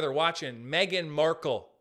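normal talking voice

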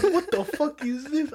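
high, slow, stunned what the fuck; shocked outburst. Retold or imitated. Retelling finding something in his food, high shocked cry. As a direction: recoil at the find, voice leaping up.

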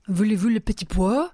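put-on French accent, curt baker. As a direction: ask it like a Paris shopkeeper.

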